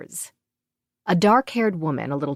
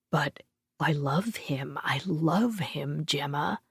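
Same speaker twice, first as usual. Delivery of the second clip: whispered; 7 dB quieter than usual. Acted. Quinn whispers back to Gemma.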